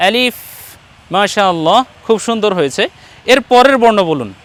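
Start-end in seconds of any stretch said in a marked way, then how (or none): 0.1-0.3 আলিফ ending in a long airy f; teeth-on-lip f. Shown as a demonstration. Teacher shows learner the proper way, আলিফ with a sustained f. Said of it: "ওপরের দুই দাঁত নিচের ঠোঁটের সঙ্গে লাগাবো".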